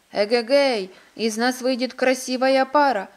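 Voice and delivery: loud, delighted storybook whoop; overjoyed. A narrator's reading. Suitor chosen by the bride, overjoyed cheer. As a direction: cheer out loud at your good luck. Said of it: "обрадовался Сеппо".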